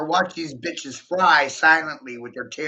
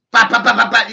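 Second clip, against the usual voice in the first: punch sounds; 8 semitones higher, 11 dB louder, faster than usual. Pretend. Mimicking rapid boxing punches.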